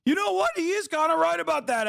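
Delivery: high, squeaky mock convert; mock right-winger. Streamer imitating a persuaded right-wing viewer, high mock voice. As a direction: pitch it way up like a gullible viewer. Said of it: "is gonna be like".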